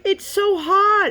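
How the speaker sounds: put-on high, slow whining little kid; whiny child. Daughter complaining the barbecue burned her, mock whine. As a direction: stretch it out like a burnt toddler.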